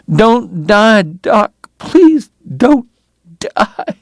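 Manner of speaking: high, breaking plea fading to breath; desperate begging. A narrator's reading. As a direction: plead through tears, voice cracking. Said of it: "she begged".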